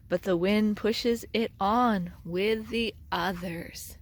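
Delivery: ordinary delivery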